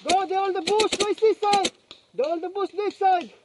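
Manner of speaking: loud urgent callout; shouting callouts. Real, not acted. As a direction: yell the enemy position to the team. Calling out enemy positions.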